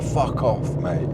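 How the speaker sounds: lightly imitating the Eastern European bloke telling him off; mock Eastern European accent. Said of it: "like, Eastern European or something".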